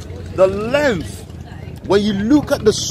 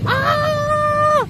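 a very high, joyful scream; ecstatic shout. Real, not acted. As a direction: scream out pure delight. Shouting with joy.

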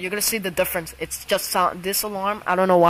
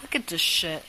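ordinary talk

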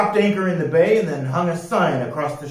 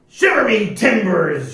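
loud, high pirate oath; shouting pirate; 8 semitones higher, 7 dB louder than usual. A narrator's reading. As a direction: shout it as an outraged captain. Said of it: "shouted Pete".

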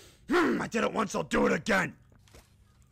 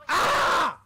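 loud high aah; frustrated yell; 9 semitones higher, 7 dB louder than usual. Real, not acted. Player venting at the game, loud frustrated yell. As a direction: let out an angry cry.

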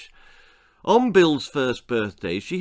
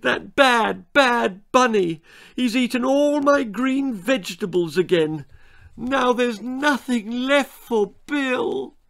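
wailing mother; 11 semitones higher than usual. Acted. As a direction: wail like a distraught mum. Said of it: "she wailed".

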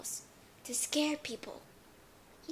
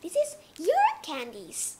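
homeowner giving candy; 13 semitones higher, 6 dB louder than usual. Acted. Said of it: "Give to them".

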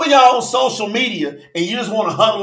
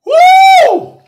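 excited whoop; 9 dB louder than usual. Real, not acted. Preacher whoops during sermon.

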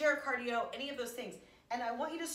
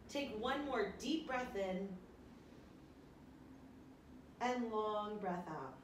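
slow calm breathing; 3 dB quieter, slower than usual. Shown as a demonstration. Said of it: "slow breathing cue".

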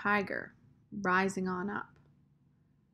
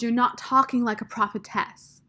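louder, mildly accusing; pointed, raised-voice accusation; 3 semitones higher, 7 dB louder than usual. A narrator's reading. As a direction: throw it at them as an accusation. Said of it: "she accused".

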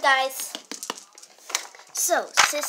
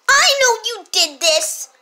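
louder, pointed accusation; accusing sister; 6 semitones higher, 7 dB louder than usual. Acted. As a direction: accuse them outright.

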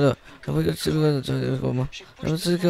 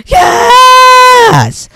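screamed, very high, drawn-out retort; full-volume comic quarrel; shouted insistence; 14 dB louder than usual. Acted. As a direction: outyell your opponent. Man shouts back louder still.

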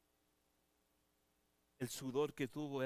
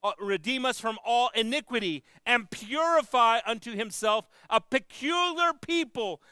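high, loud, slow scripture cry; fiery preaching; 10 semitones higher, 14 dB louder, slower than usual. Acted. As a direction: proclaim it to the church at full force. Preaching scripture at full force.

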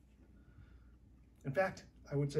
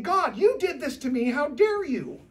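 loud, high, indignant cry at God; a believer yelling at God in his anger, loud and high; angry accusation; 8 semitones higher, 10 dB louder than usual. Acted. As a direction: hurl it at heaven, outraged. Voicing an angry prayer at God.

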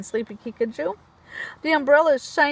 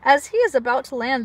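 usual speaking voice

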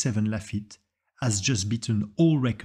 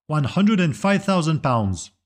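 slow, loud, higher auctioneer call; 4 semitones higher, 5 dB louder, slower than usual. Acted. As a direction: announce the bid like an auctioneer. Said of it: "he hears the auctioneer announce".

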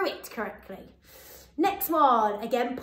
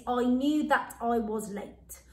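slow dictation; slower than usual. Shown as a demonstration. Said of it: "our sentence today".

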